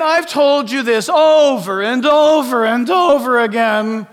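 Paul shouting his repeated warning; Paul's exasperated shout. Acted. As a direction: shout it as the weary apostle. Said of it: "Paul is saying".

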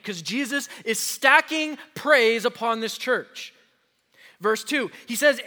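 normal talking voice

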